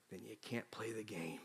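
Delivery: very low, hushed reply; quiet resolve. Retold or imitated. Hurting child completes the parent's line, quiet resolve. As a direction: answer softly after having cried.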